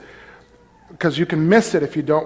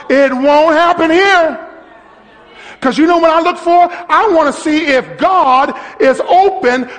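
higher, louder fervent sermon; full-force preaching; 9 semitones higher, 4 dB louder than usual. Acted. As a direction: drive it home to the congregation.

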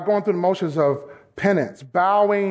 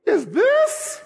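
high, slow incredulous question with a laugh; a mock-stern God, amused; God's voice; 11 semitones higher, slower than usual. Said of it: "this is God".

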